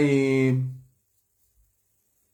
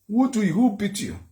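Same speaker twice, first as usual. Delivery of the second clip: worried mother; 8 semitones higher than usual. Retold or imitated. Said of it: "My mom said".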